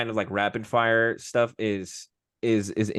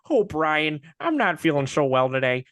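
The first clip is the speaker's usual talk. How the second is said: slightly higher, whiny feeling-sick voice; cartoon dad voice; 6 semitones higher, 3 dB louder than usual. A cartoon dad telling his dog he feels unwell, whiny. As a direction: moan it like a dopey dad. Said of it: "And he's like".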